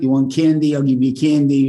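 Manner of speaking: put-on hurried bribe to a crying child; urgent placating. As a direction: offer anything to stop the tears. Acts out placating a crying sister.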